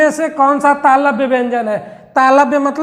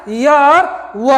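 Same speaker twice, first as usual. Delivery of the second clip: slow answer; much slower than usual. Shown as a demonstration. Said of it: "slow stressed answer".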